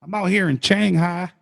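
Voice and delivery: slow, hammed-up American Southern accent; joking Southern US drawl; mock Southern accent. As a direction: imitate a Southerner, stretching the words out. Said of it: "like, the southern states and stuff".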